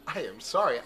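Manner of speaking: usual speaking voice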